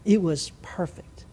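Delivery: hushed awe at the view; awed whisper. Real, not acted. Pilot remembering the perfect flight, hushed whisper.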